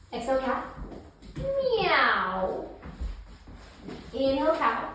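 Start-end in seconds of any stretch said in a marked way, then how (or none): 1.4-2.7 high, long meow gliding down; cat meow. Shown as a demonstration. Yoga teacher exhaling into cat pose, long meow. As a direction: let a playful meow slide downward. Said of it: "exhale and say".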